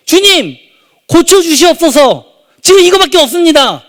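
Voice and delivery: high, loud, pleading cry to God; desperate father's prayer, loud and high; fervent loud prayer. Retold or imitated. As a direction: pray out with all your strength. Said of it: "정말 기도를 세게 했어요".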